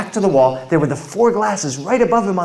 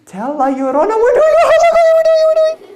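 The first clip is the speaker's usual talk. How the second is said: much higher, loud, frantic scream; panicked kids; 8 dB louder, faster than usual. Retold or imitated. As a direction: shriek it out in terror.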